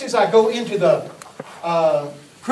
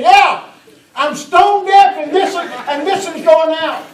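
put-on high, loud, slow deaf old man; hammed-up deaf old man; voicing the deaf old man; 9 semitones higher, 6 dB louder, slower than usual. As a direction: shout it like someone hard of hearing. Preacher voices the deaf old man.

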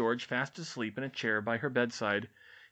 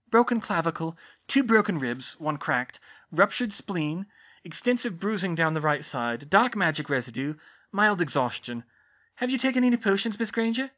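higher, brisk fussing matron; brisk school nurse; 5 semitones higher, 4 dB louder than usual. Acted. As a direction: rattle off injuries like a nurse. School nurse reads out injuries.

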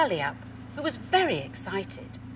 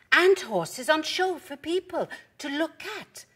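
higher-pitched explaining dog character; eager storybook explainer; Pepper; 8 semitones higher, 3 dB louder than usual. A narrator's reading. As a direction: finish the list in a raised, lively voice. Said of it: "barked Pepper".

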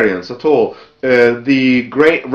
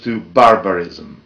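slow, lower "barbarism" with weight on it; stress on barbarism; 6 semitones lower than usual. Real, not acted. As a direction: drop the voice and press on "barbarism". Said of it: "And I stress the word barbarism".

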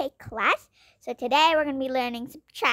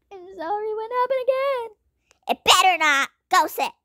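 usual speaking voice

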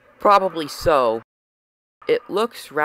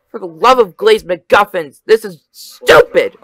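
louder, last words slowed and spat out; frustrated yell; 9 dB louder than usual. Acted. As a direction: vent your disgust at full voice. Frustrated outburst on Mars.

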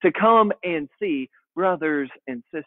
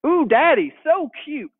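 put-on much higher cooing little girl; toddler voice; 10 semitones higher than usual. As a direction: coo like a delighted two-year-old. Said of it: "she started saying".

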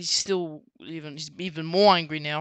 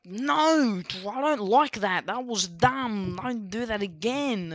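higher, drawn-out indignant protest; pissed-off retort; 4 semitones higher, slower than usual. Acted. Pete telling off the pranksters, pissed off. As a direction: scold them in a higher voice, stretching the words. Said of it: "he gets pissed off".

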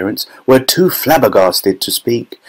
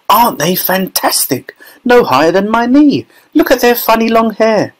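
high, thrilled exclamations; excited marvelling; 11 semitones higher than usual. A narrator's reading. Crowd marvels at tiny men.

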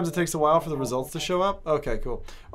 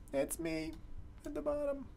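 much higher, soft self-mocking lilt; soft high sing-song self-pity; 10 semitones higher, 12 dB quieter than usual. Shown as a demonstration. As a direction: lift it up, mocking your own loss.

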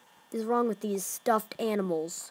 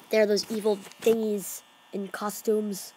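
ordinary talk, nothing marked

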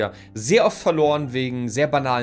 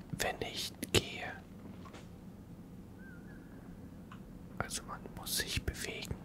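hushed whisper, keeping silent; 8 dB quieter than usual. Real, not acted. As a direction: whisper every word.

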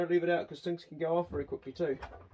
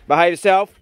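raised, sharp order to a dog; loud stern command; 3 semitones higher, 12 dB louder than usual. Real, not acted. Owner ordering his dog to behave, loud and stern. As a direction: bark the order.

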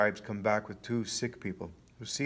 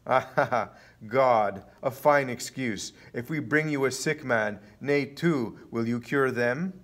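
loud, jeering laugh into a taunt; mocking challenge; 4 semitones higher, 7 dB louder, slower than usual. A narrator's reading. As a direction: scoff loudly, then goad him. Enemies mock his excuse.

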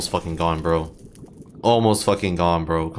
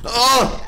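loud high frustrated grunt; frustrated groan-yell; 5 dB louder than usual. Real, not acted. Gamer fed up with ghasts, loud high frustrated grunt. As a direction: let the frustration burst out.

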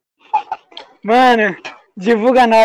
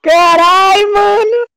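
squealing with disbelief, high and loud; overjoyed shout; 10 semitones higher, 4 dB louder, slower than usual. Real, not acted. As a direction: fan losing it, much higher. Fan overjoyed meeting youtuber.

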